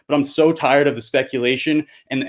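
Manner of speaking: usual speaking voice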